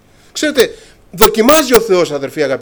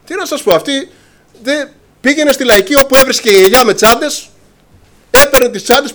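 ordinary talk

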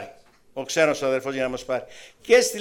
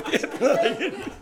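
higher, laughing scoff at the claim; a sister mocking the claim, higher and laughing; mocking retort; 9 semitones higher than usual. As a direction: tease it with a laugh. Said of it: "λέει μια αδερφή".

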